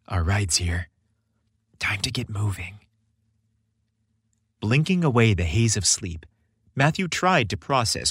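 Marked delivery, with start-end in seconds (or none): 0.1-2.8 soft, unvoiced tender whisper. A narrator's reading. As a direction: breathe it close to his ear. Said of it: "Chris whispered tenderly".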